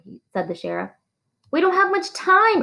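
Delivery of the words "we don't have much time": much higher, pressing and urgent. A narrator's reading. Teacher urging the drunk sheriff to hurry, high and urgent. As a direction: push her to act now. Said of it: "urged Catherine".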